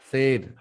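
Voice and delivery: "शेर" said as "ser"; tongue forward, s opening "शेर"; S-for-SH demo. Shown as a demonstration. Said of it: "correct is the placement of tongue". ("शेर" को "सेर" बोलें)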